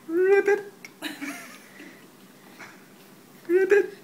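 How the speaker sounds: high, playful froggy croak; a squeaky little frog ribbit for baby. Pretend.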